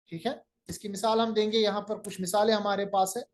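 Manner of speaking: ordinary talk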